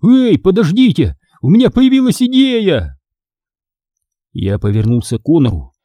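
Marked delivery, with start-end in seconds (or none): 0.0-3.0 high, urgent narrated shout; calling out. A narrator's reading. Boy halting the attackers at the cliff, crying out. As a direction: cry out with pitch leaping up. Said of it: "вместо этого закричал".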